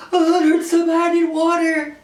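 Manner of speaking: high-pitched imitation of tearful wailing; mock near-tears. Pepper-sprayed man in the Uber, mock near-tears. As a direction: whine it like someone about to cry. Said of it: "seconds from crying".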